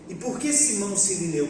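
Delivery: ordinary delivery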